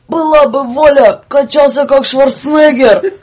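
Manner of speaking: loud, high boastful shout; excited shout. Acted. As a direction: belt it out like a dream come true. Dreams aloud, shouting.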